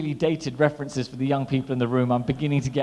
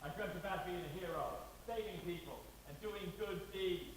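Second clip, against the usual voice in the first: soft hero voice, higher and quieter; Jackie Chan quote; 4 semitones higher, 16 dB quieter than usual. Retold or imitated. Comic quoting Jackie Chan's film line in hushed voice. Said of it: "He said".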